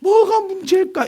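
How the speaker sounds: high, drawn-out wail of puzzlement; mock-anguished, high-pitched self-questioning; re-enacted past fretting. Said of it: "옛날에는".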